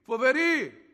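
loud, high and drawn out; mighty voice shown while describing it; stentorian proclamation. Shown as a demonstration. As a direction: boom each word out separately.

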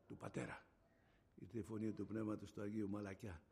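low and very quiet; soft hushed description. Shown as a demonstration. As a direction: drop to a gentle hush.

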